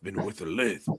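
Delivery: demonstrating a gruff, lispy impression; gruff with a lisp. Shown as a demonstration. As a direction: show how the lispy actor talks. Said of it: "You gotta do it kinda gruff".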